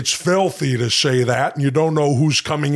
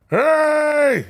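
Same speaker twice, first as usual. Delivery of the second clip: high comic yelp; Conan impression; 13 semitones higher than usual.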